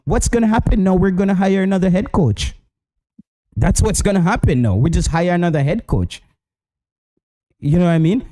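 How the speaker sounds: ordinary delivery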